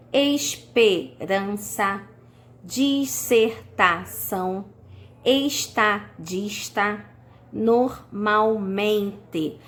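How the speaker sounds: palavras longas partidas, bem lentas; separando sílabas. Shown as a demonstration. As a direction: dite cada sílaba isolada, com pausas. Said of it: "mais de três sílabas". (silabando bem devagar)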